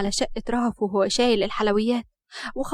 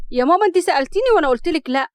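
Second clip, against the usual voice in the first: fast, louder, higher objection; disbelieving protest; 5 semitones higher, 7 dB louder, faster than usual. A narrator's reading. Daughter to mother about a suitor, disbelieving protest. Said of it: "بعدم تصديق".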